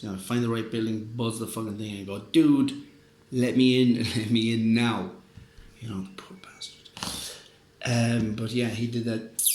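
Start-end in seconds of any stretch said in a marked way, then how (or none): 2.2-5.1 loud begging through the intercom, in a different voice; mock desperate shout at the door; pleading yell. 5.8-7.4 slow pitying whisper; soft whisper of pity; whispered aside. Real, not acted. Pitying aside after the story.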